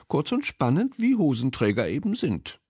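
high little mouse voice; high-pitched mouse, at an easy pace; mouse character. A narrator's reading. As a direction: echo it lightly as a small mouse. Mouse character echoing the cat.

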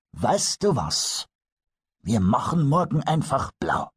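a lightly voiced old Eder making a cheerful offer; old master carpenter. Old carpenter proposes a lazy day to Pumuckl, lightly voiced.

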